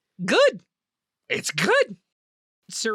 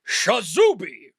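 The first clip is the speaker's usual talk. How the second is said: magic word; 3 dB louder, slower than usual. Acted. GM voices wizard casting spell.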